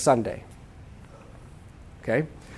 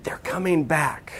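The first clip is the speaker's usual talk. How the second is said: hushed dread; 8 dB quieter, slower than usual. Acted. Child dreading parents' return home.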